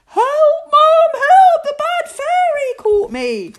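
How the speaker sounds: put-on very high, loud boyish screams; panicked screaming at full voice; shrieking. A narrator's reading. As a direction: shriek for mum to rescue you. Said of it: "Victor shrieked".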